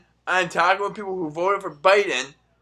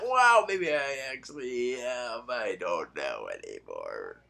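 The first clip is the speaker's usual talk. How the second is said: slow, low, deflated trail-off; resigned; 4 semitones lower, 7 dB quieter, slower than usual. Real, not acted. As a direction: give up with a sigh. Self-mocking trailing resignation.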